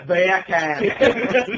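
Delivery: loud British "Beer can" through laughter; British accent that comes out as Jamaican "bacon". Shown as a demonstration. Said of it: "Say beer can in a British accent".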